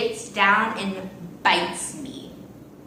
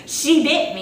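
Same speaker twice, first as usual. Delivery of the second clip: louder, higher accusation; 7 semitones higher, 7 dB louder than usual. Retold or imitated. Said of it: "But she was like".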